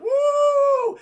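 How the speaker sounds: very high, loud held woo; jubilant whoop. Acted. Everyone whooping at the ceremony, jubilant. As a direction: whoop like a celebrating crowd.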